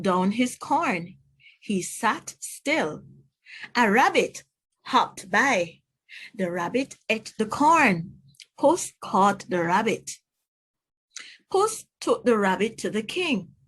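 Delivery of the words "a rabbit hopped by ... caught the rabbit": usual speaking voice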